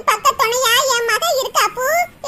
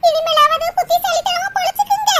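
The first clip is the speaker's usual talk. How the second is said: tearful; 3 semitones higher than usual. Acted. Mother with no money to give her departing children, tearful. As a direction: voice rising into crying. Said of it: "அம்மா, அழுகாதம்மா".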